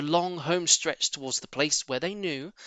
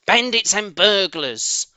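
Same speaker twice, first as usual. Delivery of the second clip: slow, louder angry shriek; 6 semitones higher, 8 dB louder, slower than usual. Acted. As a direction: hurl the insults after them. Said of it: "shrieked Rat".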